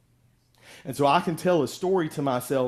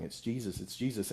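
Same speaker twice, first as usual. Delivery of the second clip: softly voiced imitation of whispering; hushed crowd murmur; 7 dB quieter than usual. Crowd passing word to blind Bartimaeus, hushed imitation of whispering.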